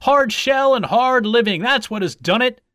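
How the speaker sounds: high, loud victory slogan; triumphant shout. A narrator's reading. Backers after the tortoise won, shouting in triumph. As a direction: crow the slogan to the crowd. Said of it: "shouted his backers".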